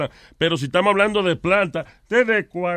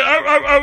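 high, loud barking bursts; imitated seal bark; 7 semitones higher, 5 dB louder, faster than usual. Comic answering the seal joke himself, high mock barks. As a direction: bark back as the seal's answer. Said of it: "de cómo ellas ladran".

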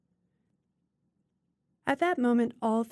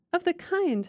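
higher, louder indignant exclaim; 9 semitones higher, 4 dB louder than usual. Acted. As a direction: fling her words back at her, outraged. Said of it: "her mother exclaimed".

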